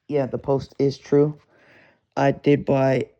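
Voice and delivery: ordinary talk, nothing marked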